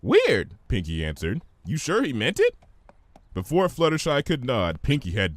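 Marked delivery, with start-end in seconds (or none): 0.0-0.6 high, bright cartoon pony; bubbly high voice. Pinkie voiced by narrator. 1.6-2.5 high, bright cartoon pony; bubbly high voice. Pinkie voiced.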